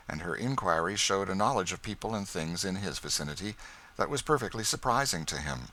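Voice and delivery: usual speaking voice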